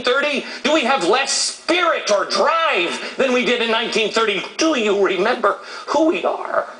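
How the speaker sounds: high, insistent rhetorical questions; fired-up challenge to the audience; impassioned oratory. Real, not acted. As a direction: build question on question to rouse the crowd.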